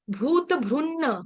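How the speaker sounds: भ्रुन्न with a half न then a full न; pronunciation demo. Shown as a demonstration. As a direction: draw out the double न in भ्रुन्न. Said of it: "ये double न है". (भ्रुन्न में आधा न फिर पूरा न)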